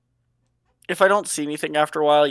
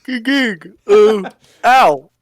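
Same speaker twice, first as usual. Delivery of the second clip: mid-yawn, louder and higher; 6 semitones higher, 11 dB louder, slower than usual. Real, not acted. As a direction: talk through a painful yawn. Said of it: "Oh, it hurts to yawn".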